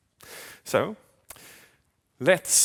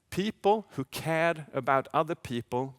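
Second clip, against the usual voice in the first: usual speaking voice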